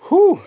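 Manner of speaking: high sharp whew of pain; sharp exhale from heat. Real, not acted. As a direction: blow out the burning heat. Eating a super hot pepper.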